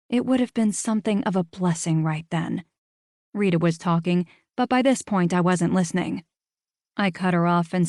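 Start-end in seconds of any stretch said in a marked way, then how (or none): none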